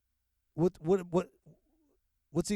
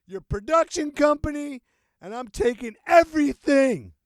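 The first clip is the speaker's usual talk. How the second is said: much higher and much louder, a shrill outraged plaintiff; mock sue-happy whiner; 11 semitones higher, 10 dB louder than usual. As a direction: ham up an indignant person threatening to sue. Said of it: "they're gonna".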